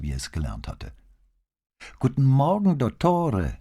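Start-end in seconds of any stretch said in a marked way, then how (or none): 2.0-3.6 bright, higher, louder greeting to the doctor; nun greets the doctor loudly and clearly. A narrator's reading. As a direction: project it, clear and cheerful like a nun. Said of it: "sagte die Nonne laut und deutlich".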